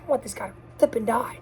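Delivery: mock vengeful mutter. Fan imagining revenge on the High Evolutionary, mock muttered. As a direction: grumble it under your breath, half joking. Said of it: "You're like".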